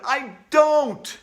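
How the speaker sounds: high, very loud shout; boy refusing at the altar. Acted. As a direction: yell with everything you have. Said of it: "I shouted as loud as I could".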